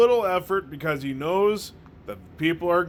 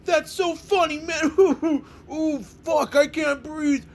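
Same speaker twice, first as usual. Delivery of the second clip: exaggerated fake crying-laughter, much higher voice; hammed-up laughing fit; 12 semitones higher than usual. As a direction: ham up laughing to tears, gasping for air. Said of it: "No, nothing like".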